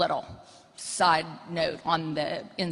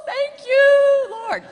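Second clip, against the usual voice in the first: a high, loud, joyful "Thank you, Lord"; thankful exclamation; 9 dB louder, much slower than usual. Real, not acted. Mother rejoicing that her kids moved out, high and loud. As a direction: cry out in mock gratitude to heaven. Relief that kids left home.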